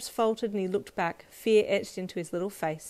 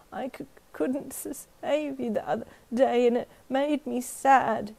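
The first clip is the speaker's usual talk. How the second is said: put-on high, slow, halting tearful boy; stuttering whimper; 5 semitones higher than usual. A narrator's reading. Boy confessing to his friend, stuttering whimper. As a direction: catch on the words as if sobbing. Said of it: "stuttering every second word".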